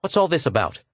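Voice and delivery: demanding attention; abrupt. A narrator's reading. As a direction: break in abruptly. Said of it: "said Arverdon abruptly".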